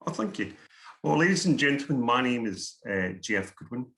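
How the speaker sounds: ordinary talk, nothing marked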